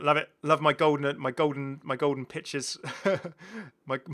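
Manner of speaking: ordinary talk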